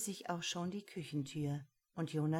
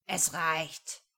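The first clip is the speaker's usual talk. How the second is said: sudden roar; 4 dB louder than usual. A narrator's reading. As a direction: bark it out, louder. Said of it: "brüllte er plötzlich".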